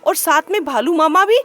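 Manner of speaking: high, fast, prying fox voice; sly fox auntie asking, fast and high; curious fox. Acted. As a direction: wheedle the news out of him quickly. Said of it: "वह खरगोश से बोली".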